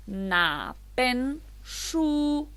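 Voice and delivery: "na pen shu" very slow, each syllable apart; slow Chinese model. Shown as a demonstration. Teacher reads the new phrase very slowly for students. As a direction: stretch every syllable out for learners. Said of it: "Luego tenemos".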